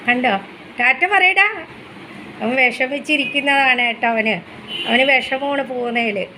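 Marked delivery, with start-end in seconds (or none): 0.8-1.5 higher, louder playful urging. Real, not acted. Mother urging her son to say ta-ta, playful coaxing. Coaxing baby to wave bye.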